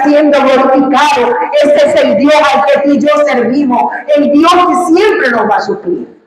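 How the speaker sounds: fervent pulpit cry rising to a shout; preaching build-up. Acted. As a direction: climb step by step until you shout the praise. Testimony rises into preaching climax.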